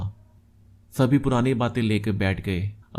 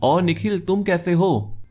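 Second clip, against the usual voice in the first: higher-pitched, surprised hello; surprised greeting; 7 semitones higher than usual. A narrator's reading. Old friend startled to meet Nikhil at the lift, surprised. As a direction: greet with a pleasant jolt of surprise. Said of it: "वो भी मुझे देखकर चौंक गया".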